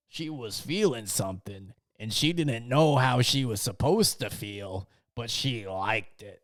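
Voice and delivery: bad German-Canadian narrator impression; odd, put-on German-Canadian storytelling voice; weird German-Canadian accent. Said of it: "some weird German Canadian".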